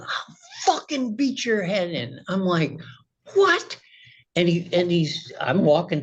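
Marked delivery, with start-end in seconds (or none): 0.0-2.2 imitated furious threat, pitched higher; mock the neighbor's shouted threat; retold threat. Neighbor charging with fists raised, threat imitated higher. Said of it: "two fists in the air going". 3.3-3.8 sharp, much higher startled yelp; shocked what. Retold or imitated. Threatened by the neighbor, startled and much higher.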